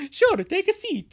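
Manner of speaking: high, loud put-on voice; high loud character voice. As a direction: squeal the invitation. Dubbing character line.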